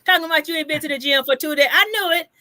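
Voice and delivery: high-pitched, excited crowing; triumphant I-told-you-so. Real, not acted. Host learns guest is off to work out, gleefully vindicated. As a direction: revel in having guessed right. Crowing that she guessed right.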